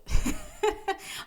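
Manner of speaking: high, loud laugh; high breathy laugh. Real, not acted. Laughing at her public crying idea, a real high laugh.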